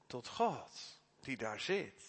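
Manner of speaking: ordinary delivery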